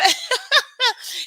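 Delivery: sudden high-pitched laugh; high burst of laughter. Real, not acted. Host laughing at a viewer who claims first, high burst of laughter. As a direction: crack up high and loud.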